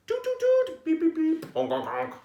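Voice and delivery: playful horn sounds; bus horn noises. Acted. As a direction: imitate the noisy bus horns.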